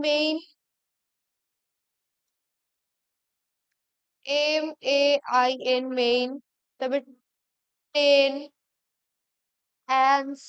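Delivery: মেইন, টেন, অ্যান্স broken apart with long pauses; syllable by syllable. Shown as a demonstration. Teacher breaks maintenance up for spelling, syllable by syllable মেইন, টেন, অ্যান্স. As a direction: spell out and sound each chunk of মেইন, টেন, অ্যান্স separately. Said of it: "syllable ভাগ করে বলতে পারি".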